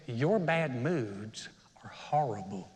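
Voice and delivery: slow, whispery sweet southern lady; hushed, sugary southern imitation; southern accent. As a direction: draw it out softly in a mock southern accent. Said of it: "had a southern accent like this".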